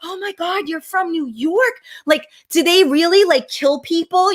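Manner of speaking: mock wide-eyed tourist asking in awe; imitating awed onlookers. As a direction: start small and high, then blurt the question. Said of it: "They're like".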